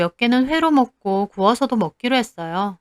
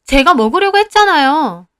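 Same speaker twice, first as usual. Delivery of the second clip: protesting; 5 semitones higher, 5 dB louder than usual. Acted. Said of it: "저도 못 참고 따졌죠".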